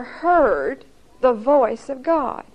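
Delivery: heard the voice of God drawn out word by word; slow down and weigh each word; solemn, deliberate stress; slow, weighty emphasis. Acted. Preacher's key point.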